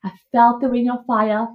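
ordinary talk, nothing marked

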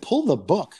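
"Pull the book?" as a baffled question rising at the end; incredulous echo. Retold or imitated. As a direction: repeat "Pull the book?" in disbelief, lifting on "book". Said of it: "I said".

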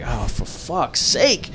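slow, drawn-out exasperation; exasperated groan. Retold or imitated. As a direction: drag out every word in retold dismay. Retold dismay at customer's return.

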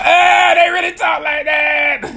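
high, drawn-out shout of retold amazement; hammed-up astonished yell; mock amazed holler. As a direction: holler it slowly, way up high, breaking into laughter. Retells own amazed reaction to accent.

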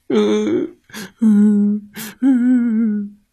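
high whimpering hums; whale whimpers. Acted. Whale whimpers sadly inside the monster's belly.

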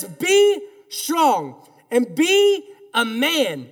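slow, weighty words to a son; father's charge. Retold or imitated. David tells his son Solomon, slow and heavy. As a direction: lean on each word like a dying king. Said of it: "He looks at his son, and he says".

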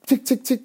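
very high clicky tick tick of a pickaxe; high ticking pickaxe noise. Retold or imitated. Dreamer imitating his pickaxe on the diamond, high ticking. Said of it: "it sounds like this".

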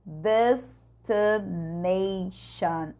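"destination" drawn out very slow with a closed I; slow demo of the E-like I. Shown as a demonstration. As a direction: stretch "destination", I sounding like E. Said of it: "Olha o I fechado".

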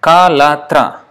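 kalatra stretched slowly; slow, clear kalatra; slow syllable demo. Shown as a demonstration. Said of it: "that is three syllables".

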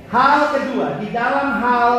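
ordinary delivery

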